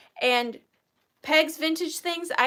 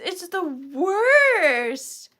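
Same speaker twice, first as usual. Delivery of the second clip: drawn-out lament; 5 semitones higher, 6 dB louder, much slower than usual. Real, not acted. Reacting to granddaughter no kisses.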